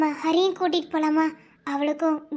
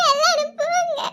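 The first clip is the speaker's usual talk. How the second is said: sobbing dismissal; 10 semitones higher, 4 dB louder than usual. Acted. Sister crying, sending everyone away.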